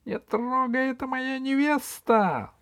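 high, squeaky little gnome voice, pleading; shrill small creature guarding his bride; crying out gnome. Acted. Gnome Веня defends his bride from the villains, high squeaky cry. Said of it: "закричал Веня".

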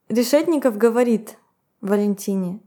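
normal talking voice

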